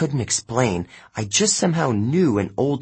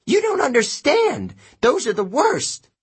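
a touch of a higher, frantic witch; panicked sorceress; 14 semitones higher than usual. A narrator's reading. Sorceress warning about the freed pirates, higher and frantic. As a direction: plead in alarm as the sorceress. Circe's voice held.

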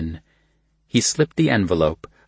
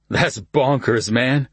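put-on drunk slurring; 5 semitones higher, slower than usual. A narrator's reading. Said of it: "slurring their words".

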